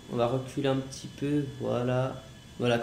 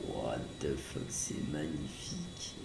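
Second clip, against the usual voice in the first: awed; 12 semitones lower, 12 dB quieter, slower than usual. Real, not acted. Amazed at the view.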